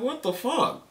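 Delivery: high, incredulous outburst; shocked that the boss took his side; outraged disbelief. Retold or imitated. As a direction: pitch shoots way up in disbelief. Boss takes customer's side.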